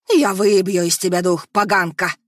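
loud, enraged spirit voice; furious ghost scream. Acted. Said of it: "Яростно закричала Архелия".

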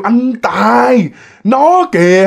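wife crying out to her husband at the ghost; calling out. Retold or imitated. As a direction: call the husband's name in alarm. Trying to call out to husband.

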